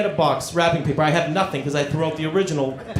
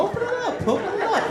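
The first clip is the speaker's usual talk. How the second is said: much higher, excited coaxing; eager high urging; 5 dB quieter than usual. Retold or imitated. Husband coaxing wife to open the gift, high and eager. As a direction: urge her to open it, pitched way up.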